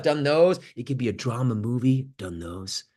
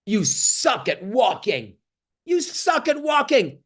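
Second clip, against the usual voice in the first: higher, slow mock put-down; mock harsh critic; 7 semitones higher, slower than usual. Imitating a critic mocking a baby's first steps, slow and sneering. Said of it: "they didn't say".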